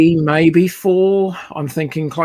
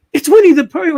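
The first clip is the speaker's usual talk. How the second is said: incredulous wife; 13 semitones higher, 5 dB louder than usual. Retold or imitated. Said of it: "she's like".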